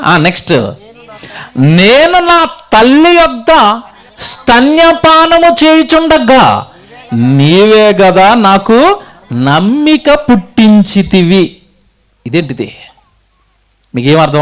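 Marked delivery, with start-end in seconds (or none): 1.6-11.6 slow, high, drawn-out verse reading; declaimed scripture reading. Acted. Preacher reads next verse to congregation, slow declamation. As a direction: intone each phrase of the verse slowly.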